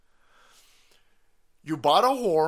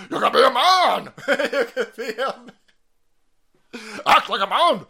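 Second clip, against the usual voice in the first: loud, higher taunting bark between laughs; mock yell; 5 semitones higher, 7 dB louder than usual. Host taunting the son to man up, loud laughing yell. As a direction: bark it at him, half laughing.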